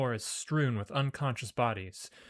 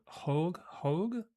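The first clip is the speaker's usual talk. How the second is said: unsure questioning; 6 semitones higher than usual. Shown as a demonstration. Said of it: "working out the name".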